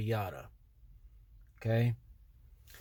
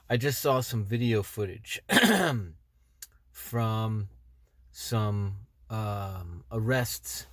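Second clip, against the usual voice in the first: numb from the dentist; 5 semitones lower, 4 dB quieter, slower than usual. Real, not acted. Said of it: "My face is numb".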